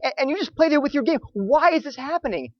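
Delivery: acted distraught plea, pitched high; high, agitated outcry of a shaken man; shaking, trembling. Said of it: "he's shaking and trembling".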